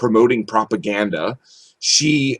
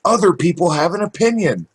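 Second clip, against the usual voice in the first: high-pitched mock presentation of proof; mocking quote; 7 semitones higher than usual. Co-host mimics the man defending his war claim, mocking. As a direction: imitate his argument in a higher, mocking voice. Mockingly voicing a commentator's weak argument.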